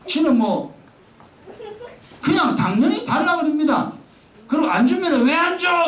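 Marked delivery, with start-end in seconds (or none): 5.3-5.9 put-on child protesting.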